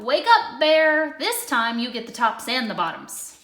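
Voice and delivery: loud wake-up shout; calling out. A narrator's reading. Hare calls Bear at the last harvest, loud shout. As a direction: holler for Bear over the field. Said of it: "Hare called out".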